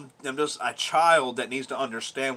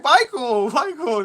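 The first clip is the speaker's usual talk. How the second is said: high, loud call of a name; imitated mom; 13 semitones higher, 9 dB louder, much slower than usual. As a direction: mimic a mother exclaiming at her child. Voices his mom calling his name.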